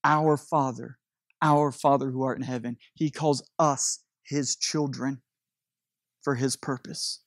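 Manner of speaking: prayerful. Acted. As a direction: hush down and pray it. Quiet prayerful passage.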